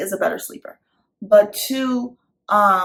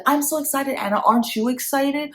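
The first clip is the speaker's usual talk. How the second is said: higher, bright-eyed cheering voice; excited for Anna; 6 semitones higher than usual. Shown as a demonstration. Mom cheering her toddler about becoming a sister. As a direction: hype the child up with you. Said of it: "I would be like".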